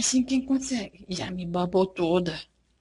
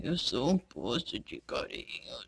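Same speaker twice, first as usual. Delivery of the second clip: much lower, softer voicing of the pet; 11 semitones lower, 9 dB quieter than usual. Mom voicing the pet as a well of affection, low and soft.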